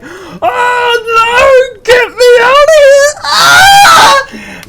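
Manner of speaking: very high, loud mock-wailing scream; imitating panicked crying. Mimicking Callum wailing to escape the haunted house, panicked crying scream. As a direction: shriek like someone desperate to get out. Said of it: "Callum's fucking crying".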